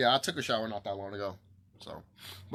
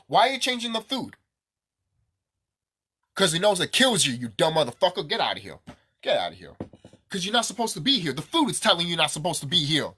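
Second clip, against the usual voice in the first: loud, higher, relentless outrage; furious rant; 4 semitones higher, 8 dB louder than usual. Real, not acted. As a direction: keep yelling at them, no let-up. Angry rant about poisoned food.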